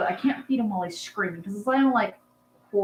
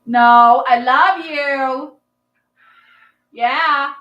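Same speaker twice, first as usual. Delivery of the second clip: slow, higher sweet talk to a bird; cooing at pet; 5 semitones higher, 10 dB louder, much slower than usual. Real, not acted. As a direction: soothe an upset pet, drawn out and higher. Soothing the angry bird.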